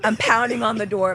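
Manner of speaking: ordinary talk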